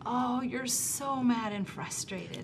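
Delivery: slow, soft and tender; tender empathy. Shown as a demonstration. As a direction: say it gently to a mad child. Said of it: "a totally different experience than me saying".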